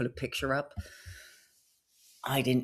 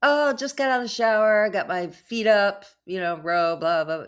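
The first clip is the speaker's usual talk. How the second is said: lightly voiced, higher casual charmer; smooth scammer; 6 semitones higher, 5 dB louder than usual. Scammer chatting up her after his shower, mild charm. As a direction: toss it off like a lazy flirt. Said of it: "he said something like".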